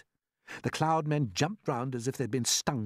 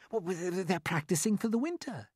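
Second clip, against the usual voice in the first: James's voice; 7 semitones higher than usual. A narrator's reading. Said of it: "James told him".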